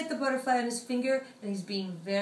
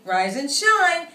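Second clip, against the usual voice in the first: sing-song wake-up call; 5 semitones higher, 8 dB louder, slower than usual. Acted. Mother chicken waking her child.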